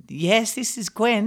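imitating nana. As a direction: answer like a polite grandmother. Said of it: "she's like".

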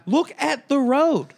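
slow, loud, high yell; exasperated shout, drawn out; angry outburst. Retold or imitated. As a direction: hammer each word at the driver. Imagined outburst at the driver.

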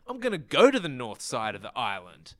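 put-on high, defiant teen voice; lightly mocked defiant teenager; nineties teenager. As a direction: act like a rebellious nineties teen. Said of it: "teenagers acted back then".

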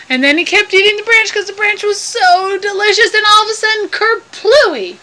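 high, building storyteller excitement; excited narration. A narrator's reading. Storyteller reaching the moment the branch gives way, excited. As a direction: tell it high and eager, up to the tumble.

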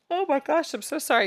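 apology stretched through a real yawn. Real, not acted. Host apologising to listeners mid-yawn, yawning. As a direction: let the yawn pull the words high. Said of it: "Excuse me as I yawn again".

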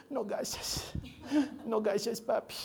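put-on faint, weak baby voice; a sick little girl whimpering thanks; sick toddler voice. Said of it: "habla como bebé todavía".

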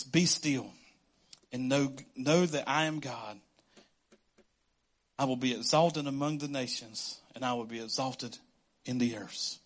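low, soft scripture reading; quiet reverent psalm. Acted. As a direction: read it hushed and holy.

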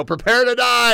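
high, loud captain's call; submarine command shout. Retold or imitated. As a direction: bark it like a sub captain. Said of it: "they would go".

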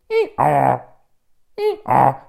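two loud hee-haws; donkey bray. Acted. Joey shows what a donkey sounds like, braying. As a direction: bray like a donkey, high then falling. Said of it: "A donkey goes".

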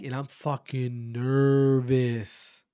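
slow, stretched "fucking nervous" with weight on nervous; drawn-out nervous. Retold or imitated. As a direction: drag out the words as if reliving the nerves. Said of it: "I get up to the mic".

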